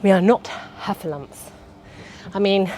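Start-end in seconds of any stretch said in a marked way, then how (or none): 0.0-1.5 a hint of a stern ballet teacher; lightly voiced strict dance teacher scolding. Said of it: "As my ballet teacher used to say".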